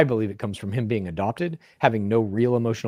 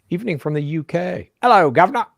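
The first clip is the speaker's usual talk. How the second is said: higher, louder mock British accent; 7 semitones higher, 6 dB louder than usual. Reading a fan's greeting in a mock British accent. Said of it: "That was my British".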